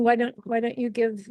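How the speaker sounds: usual speaking voice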